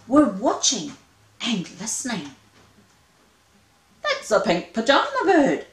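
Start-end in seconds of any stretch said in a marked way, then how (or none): 4.0-5.7 higher and louder in wonder; amazement. Acted. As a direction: gasp at a rare sight. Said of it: "they cried in absolute amazement".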